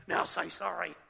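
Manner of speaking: put-on telling a naughty kid to apologise; stern parent. Parent ordering a child to say sorry, firm and loud. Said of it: "your parents say".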